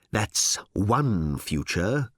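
very slow, deliberate counter; unhurried, knowing reply; measured correction. Acted. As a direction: weigh out each word. Harl corrects Ford slowly.

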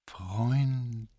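slow, high, dragged-out word; sluggish drawl. A narrator's reading. Same slow childlike woman voice.

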